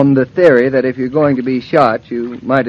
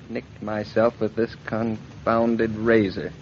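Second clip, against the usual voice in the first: usual speaking voice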